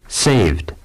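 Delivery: ordinary talk, nothing marked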